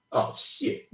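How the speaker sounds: breathy shocked whisper under the breath; whispered oh shit. Retold or imitated. Watching his friend kiss his girl, a shocked whisper. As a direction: hush it out, stunned. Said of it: "I was like".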